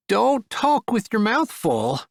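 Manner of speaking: high, slow scolding aunt. Acted. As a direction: chide the child slowly.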